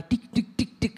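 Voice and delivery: light, quick "dik" taps; imitated little footsteps. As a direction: mimic a small child's running steps. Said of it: "ada langkah-langkah kecil berlari".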